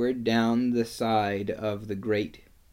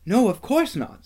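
put-on high-pitched girl's protest; shocked denial; 11 semitones higher, 4 dB louder than usual. A narrator's reading. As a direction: dismiss the idea, voice raised high. Susan answers Lucy, shocked.

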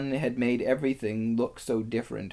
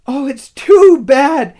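very high, loud, distraught wail; sobbing outcry; 19 dB louder than usual. A narrator's reading. Lucy over the broken table, sobbing wail. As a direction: wail it like a heartbroken girl. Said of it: "sobbed Lucy".

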